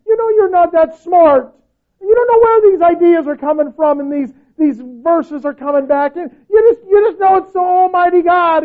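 high, loud preaching with a cry in the voice; impassioned preaching. Acted. Pastor urges the timid believers, crying preaching. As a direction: pour everything into the climax. Climax of the sermon.

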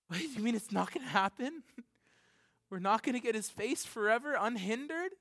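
high, stunned question with a laugh; disbelieving John. Retold or imitated. John hearing no one can open the scroll, dismayed disbelief. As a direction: ask it as if the news can't be true. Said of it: "if I was John".

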